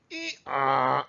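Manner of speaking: drawn-out hee-haw; donkey bray. Acted. Teacher answering what a donkey says, braying. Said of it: "What does a donkey say?".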